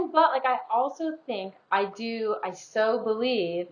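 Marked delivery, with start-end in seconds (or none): 1.7-3.7 slow and softer, welling up; getting emotional. Real, not acted. Talking to her friend about choosing the positive, emotional. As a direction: voice catching as feeling rises. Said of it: "I'm gonna get emotional".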